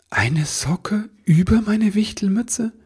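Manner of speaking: high, slow, puzzled little elf; rising, drawn-out disbelief; bewildered. Acted. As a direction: ask it utterly at a loss. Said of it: "verstand die Welt nicht mehr".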